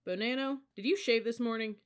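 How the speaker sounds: loud, high-pitched demand; sharp challenge. A narrator's reading. Senior cadet inspecting a recruit's face, loud accusing question. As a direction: challenge him loudly, pitched well up.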